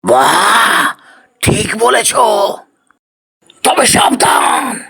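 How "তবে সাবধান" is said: high, shouted ominous caution; dire shouted warning. Acted. Spirit warning the brothers of the death trap, shouting high. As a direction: warn them as if doom awaits.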